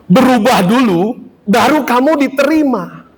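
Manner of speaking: mock demanding world.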